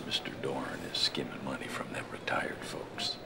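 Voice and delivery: hushed conspiratorial whisper. Acted. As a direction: lean in and whisper it. Lawyer shares a secret.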